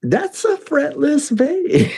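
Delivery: high, slow starstruck awe; lightly hammed-up fanboy wonder; awed fanboy. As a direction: marvel at it like a dazzled fan. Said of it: "But I did go".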